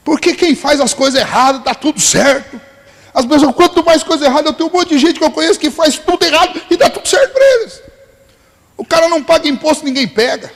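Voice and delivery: high, aggrieved rant, retold in full flow; imitating an irate friend. Irate friend complaining that God ignores him, high angry rant. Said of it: "ele ficou extremamente irado".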